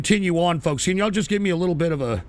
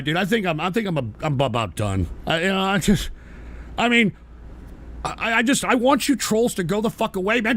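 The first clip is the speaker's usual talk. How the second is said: normal talking voice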